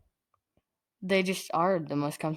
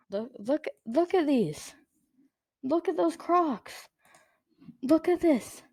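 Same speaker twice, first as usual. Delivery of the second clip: excited; 9 semitones higher than usual. Real, not acted. Showing off his Crocs excitedly.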